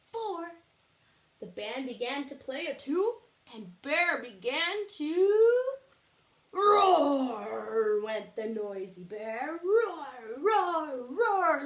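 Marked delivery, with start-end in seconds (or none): 3.6-8.0 slow build to a loud shouted roar. Acted. Teacher acting Bear's roar, loud. Bear roars. 9.6-11.6 loud, rising roars; roaring. Acted. Bear roaring for the audience, loud.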